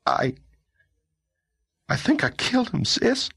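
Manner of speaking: put-on mild, high boyish fear; a scared kid confessing to his sister; frightened boy. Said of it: "in a frightened voice".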